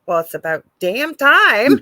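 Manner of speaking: loud, delighted blurt; proud mom finally hearing it; gleeful outburst. Retold or imitated. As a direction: throw it out with joyful relief. Mom's exuberant reply to son.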